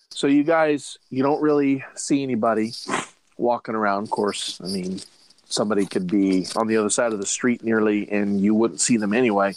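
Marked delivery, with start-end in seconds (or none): none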